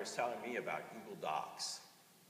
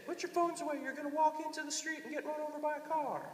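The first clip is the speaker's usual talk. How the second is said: nagging adult. Retold or imitated. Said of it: "when people say".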